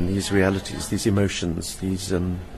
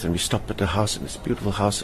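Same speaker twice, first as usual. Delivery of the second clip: normal talking voice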